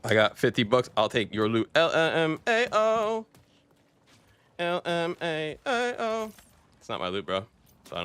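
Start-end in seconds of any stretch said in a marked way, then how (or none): 1.8-3.2 mock laugh reading. Reading chat aloud, mocking. 4.6-6.3 drawn-out mock read. Repeats chat slower, stretched.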